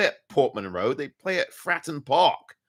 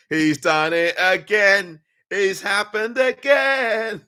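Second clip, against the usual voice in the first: mock commentator; 6 semitones higher, 5 dB louder, slower than usual. Real, not acted. Mocking own repeated blunder.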